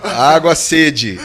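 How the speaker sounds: loud, slow caricature of pidgin; mock broken speech. Beginner begging locals for water, loud mock broken speech. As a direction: punch out each bare word separately. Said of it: "parecia um índio".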